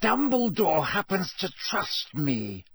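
high, slow, tightly controlled anger; tense, indignant retort; through clenched teeth. A narrator's reading. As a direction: force each word out through clenched teeth. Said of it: "said Snape through clenched teeth".

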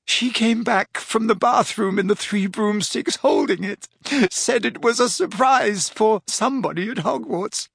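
put-on a crying girl's voice breaking into sobs; tearful. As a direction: sob out the whole confession. Leanne crying throughout.

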